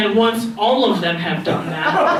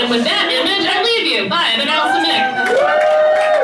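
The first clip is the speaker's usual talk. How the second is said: high, raised goodbye to the crowd; upbeat sign-off; 4 dB louder than usual. Real, not acted. Comedian leaving the stage, high rising sign-off.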